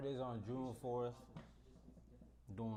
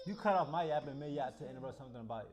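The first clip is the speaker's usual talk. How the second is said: annoyed; 2 semitones higher, 8 dB louder than usual. Real, not acted. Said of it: "I can't even talk".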